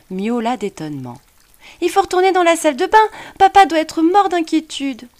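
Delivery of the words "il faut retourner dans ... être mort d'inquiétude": put-on high, excited young boy; an amazed child calling to his cat; little boy voice. A narrator's reading. Boy character's voice.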